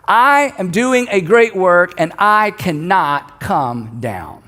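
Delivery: slow declaration. Retold or imitated. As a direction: declare it word by word, then let it fall. Delivers Nehemiah's shouted message.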